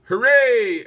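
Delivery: loud, high hooray; cheering. A narrator's reading. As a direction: cheer out for peace. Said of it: "everyone cheered".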